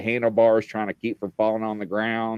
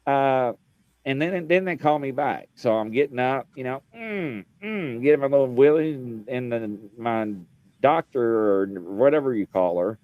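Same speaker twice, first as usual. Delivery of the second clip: ordinary delivery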